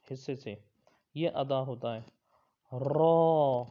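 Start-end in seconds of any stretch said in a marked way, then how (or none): 2.7-3.6 را said full and heavy, loud; a thick recited را. Shown as a demonstration. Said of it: "اور یہ بھی موٹا پڑا جائے گا". (را کو موٹا پڑھیں)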